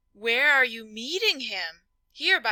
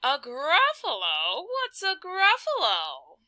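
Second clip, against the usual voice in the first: puzzled snake; 6 semitones higher than usual. Acted.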